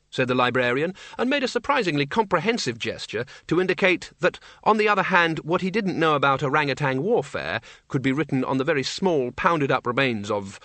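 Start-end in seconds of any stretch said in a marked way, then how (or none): none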